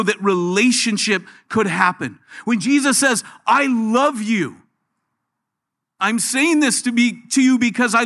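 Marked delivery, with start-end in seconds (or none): 3.5-4.6 slow tender. Acted. Preacher voicing Jesus, drawn out.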